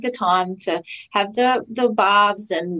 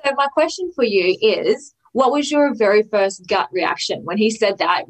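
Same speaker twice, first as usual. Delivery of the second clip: usual speaking voice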